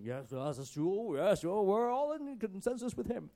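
mock chorus of eager agreement; put-on voice of a group all agreeing at once; hammed-up yes-men climbing in pitch; disciples chiming in. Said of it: "all the other disciples vowed the same".

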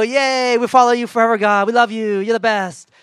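high, loud mock cheer; giddy cheering. The rescued people cheering God after the judge saves them, hammed-up delight. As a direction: gush like an overeager fan.

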